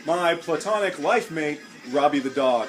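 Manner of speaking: show host voice. Acted. Host starting take four in an upbeat host voice. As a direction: sell the welcome with a grin.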